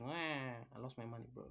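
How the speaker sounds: a hammed-up whiny wail, half-joking; mock crying. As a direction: imitate a loser moaning over lost money. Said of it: "Start crying".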